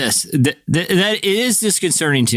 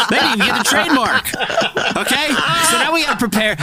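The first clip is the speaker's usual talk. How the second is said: laughing; 8 semitones higher than usual. Real, not acted. Laughing at Space Force.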